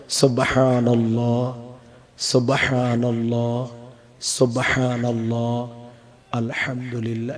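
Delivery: low, soft, drawn-out dhikr; slow, beautiful recitation. Shown as a demonstration. Preacher showing congregation proper dhikr, slow and soft. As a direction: recite it gently, every vowel given its length. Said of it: "আস্তে বলেন, সহি করে বলেন, সুন্দর করে বলেন".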